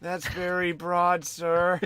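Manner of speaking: put-on a small kid minding the counter; eight-year-old boy. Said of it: "The eight-year-old".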